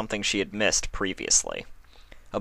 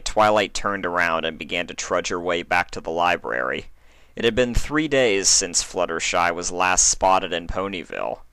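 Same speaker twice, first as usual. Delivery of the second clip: plain reading voice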